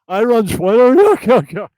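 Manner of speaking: loud, high goofy puppet cackle-talk; Jesse P S puppet. Acted. Host previews the Elon Musk puppet, goofy puppet voice. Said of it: "he's not gonna talk like that".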